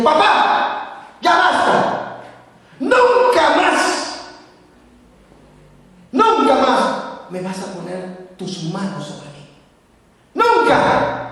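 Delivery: high, loud, slow cry of defiance; defiant cry. Retold or imitated. Son shouting at his father to stop the beating. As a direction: stop him once and for all.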